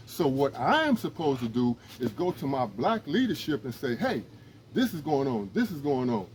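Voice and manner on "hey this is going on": ordinary talk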